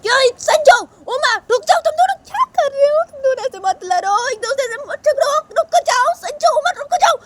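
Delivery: very high, loud shrill mother; frantic mother. Acted. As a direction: break up the fight in panic. Mother stopping a fight. (बहुत ऊँची, घबराई माँ की आवाज़)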